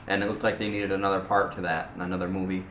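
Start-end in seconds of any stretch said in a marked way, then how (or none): none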